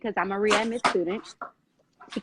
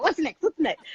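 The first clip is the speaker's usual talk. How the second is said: high, eager questioning; retold excitement; 6 semitones higher than usual. Friend pushing on with my enrollment, excited. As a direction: imitate a friend who is all excited. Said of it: "So now she's all excited".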